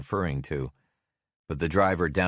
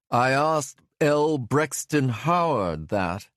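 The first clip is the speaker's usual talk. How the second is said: put-on slow, high, butchered British accent; hammed-up fake British accent; worse fake British accent; 10 semitones higher, 5 dB louder than usual. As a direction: mangle a British accent on purpose. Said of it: "butchering the accent even worse".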